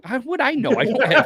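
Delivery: very high-pitched mock disbelief; mock protest. Imitating someone asked about the donations, high mock protest. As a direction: squeak out a playful imitation, breaking into laughter. Acts out baffled reply, laughing.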